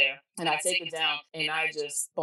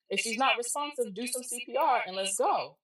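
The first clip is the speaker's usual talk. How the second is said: higher-pitched, insistent protest; retold urgent insistence; 7 semitones higher than usual. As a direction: push them to act right now. Acts out urgent refusal of news.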